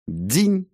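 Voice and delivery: high, short rising "ding"; ringing clink. Acted. Narrator voicing a deflected arrow, high ringing ping. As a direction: imitate metal pinging in a high voice. Imitates arrow struck away.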